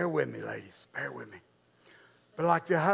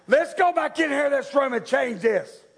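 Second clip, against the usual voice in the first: higher, louder mock-bossy order; bossy mock scolding; 8 semitones higher, 5 dB louder than usual. Wife sending kids back to change, bossy mock scolding. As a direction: hustle them off in mock dismay.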